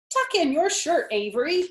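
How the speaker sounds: put-on loud, high call to a boy; fussing mother calling out. A narrator's reading. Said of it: "cried Missus Zuckerman".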